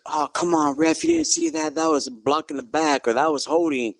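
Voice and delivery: imitating indignant fans, high-pitched; protesting sports crowd. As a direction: complain at the ref like a worked-up sideline crowd. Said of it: "I hear the crowd on our side be like".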